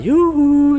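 high-pitched drawn-out "yoo-hoo" in imitation; playful hooting call. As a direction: imitate a worker's silly falsetto hoot. Imitates night workers' playful hooting. (grito agudo y juguetón, imitado)

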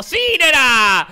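loud, slow drawn-out triumph; gloating cheer. Real, not acted. Gamer celebrating his trick, drawn-out loud cheer. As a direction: savor the moment.

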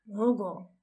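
understated, reluctant grumble; unenthusiastic grumble. A narrator's reading. Draco naming his baby, mildly grumbling and unenthusiastic. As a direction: grumble the name with little enthusiasm. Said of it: "brummte Draco wenig begeistert".

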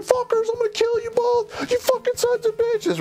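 put-on drunk threats. Restrained drunk sergeant threatening his captors in the van, high mock rage. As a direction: shriek the threats like a raging drunk.

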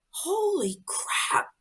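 slow, hushed amazement; awed realization. Retold or imitated. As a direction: breathe it out stunned. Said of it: "And I was like".

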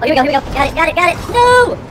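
urgent high cry building to a yell; panicked shouting. Real, not acted. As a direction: build from frantic chatter into a shout. Panic in the fight.

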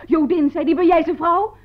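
loud mock officer's demand; imitated bullying shout. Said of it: "schreeuwde dat hij van de politie kwam".

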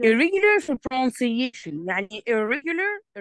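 irregular said slowly and clearly; slow teaching voice. Shown as a demonstration. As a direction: spell out irregular slowly for the student. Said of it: "teacher modelling the word". (نطق بطيء وواضح)

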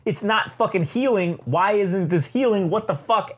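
high, alarmed yelping disbelief; panicked Hulk. Retold or imitated. Hulk panicking that his arm won't heal, high alarmed yelp. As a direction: freak out as your wound refuses to close.

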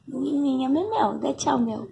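sweet little voice saying bye. Real, not acted. Asks cat to wave bye. (voz fininha e suave para a gata)

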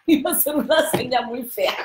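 risa mientras confiesa; laughing. Real, not acted. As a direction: habla riendo, un poco más agudo. (riéndose de su propia ocurrencia)

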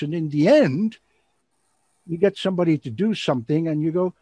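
ordinary talk, nothing marked

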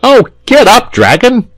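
put-on high, loud pleading child; distressed little boy crying out; piteous cry. A narrator's reading. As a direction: plead shrilly to wake him. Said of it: "cried the boy piteously".

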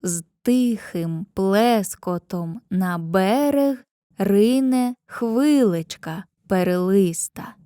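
slow, measured verse reading; poem reading. Shown as a demonstration. Teacher reading Lesya Ukrainka's poem to pupils, slow and measured. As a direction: read the lines slowly, letting the meter show. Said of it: "Візьмімо для прикладу рядки".

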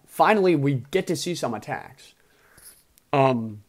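ordinary delivery

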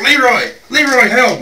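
hollering for help like a man on fire; imitated frantic scream for Leroy; panicked yell. Leroy on fire, rolling around.

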